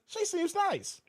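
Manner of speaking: high-pitched little mimicked voice; playful falsetto imitation; mock sweet quote. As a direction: squeak it out as a teasing quote. Mimics a quoted chat comment.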